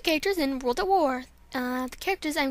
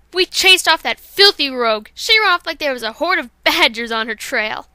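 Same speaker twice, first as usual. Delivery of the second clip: proud boast; 8 dB louder than usual. Acted. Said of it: "here's Violet Mist".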